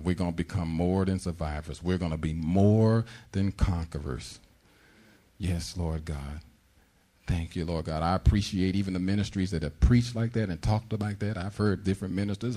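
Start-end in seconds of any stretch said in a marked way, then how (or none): none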